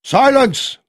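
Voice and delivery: loud, high barked order; commanding shout. A narrator's reading. Colonel quiets the rowdy pub with a shout. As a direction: silence the room with one word. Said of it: "He raised his hands".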